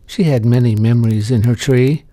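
ordinary delivery, nothing marked

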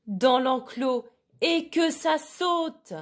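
high, drawn-out commanding call; barked megaphone order. A narrator's reading. Sheepdog ordering sheep by megaphone, high barked call. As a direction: bark it out, stretching the final words high. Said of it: "aboya-t-il dans son mégaphone".

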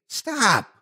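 sudden high cry of alarm; cried out. A narrator's reading. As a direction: yell it out in fright to stop him. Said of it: "Jessie cried out from behind me".

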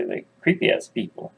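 plain reading voice

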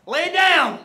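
loud coaching holler; commanding coach. Real, not acted. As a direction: call the order out to the athlete. Barking workout commands at athlete.

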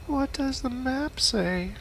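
high, slow goofy voice; silly high voice. Pretend. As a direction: stretch it out in a squeaky voice. Repeats line in silly voice.

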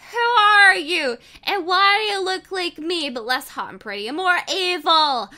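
furious high yell; angry yell. Acted. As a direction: shout it at the evil twin. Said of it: "I yelled with angry".

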